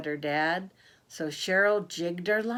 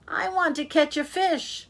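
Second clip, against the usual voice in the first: put-on high, loud childlike demand; impatient child; 9 semitones higher, 4 dB louder than usual. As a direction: whine it out, impatient for a catch. Impatient girl demands a fish.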